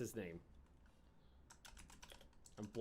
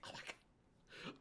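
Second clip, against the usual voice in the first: slow breathless whisper; starstruck whisper; 20 dB quieter, much slower than usual. Retold or imitated. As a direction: whisper it in awe. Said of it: "It's like".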